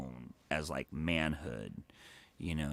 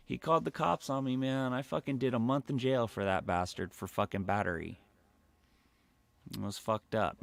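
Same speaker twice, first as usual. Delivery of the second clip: usual speaking voice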